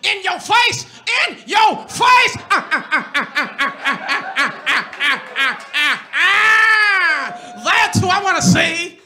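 loud high Chappelle cackle and shout; Chappelle impression. Wife does Chappelle in the car, loud high shouting cackle. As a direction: bark it like Dave Chappelle's famous yell. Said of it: "You did such an amazing Chappelle impression".

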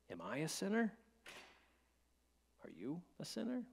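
ordinary talk

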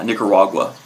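normal talking voice

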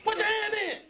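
high team-huddle call; rallying shout. Acted. Uncle leading the two men in a huddle, rallying shout. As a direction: pitch it up to rally the crew.

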